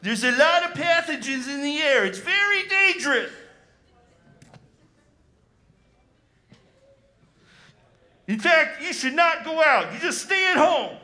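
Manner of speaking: preachy public-health warning, hammed up; Doctor Fauci impression. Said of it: "This is Doctor Fauci".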